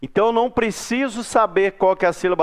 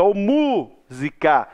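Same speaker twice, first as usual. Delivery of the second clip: drawn-out call stressing the MÚ of "música"; much slower than usual. Shown as a demonstration. Teacher pretends to call someone to find the stressed syllable, calling out MÚSICA with a long MÚ. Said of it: "O mu é a sílaba mais forte".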